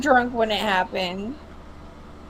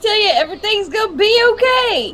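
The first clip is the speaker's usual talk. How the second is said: put-on country accent; 6 semitones higher than usual. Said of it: "get real country".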